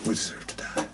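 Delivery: breathless, soft mock despair; joking whisper. Co-host reacting to the review, a joking whisper. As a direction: murmur it as a dead-pan joke.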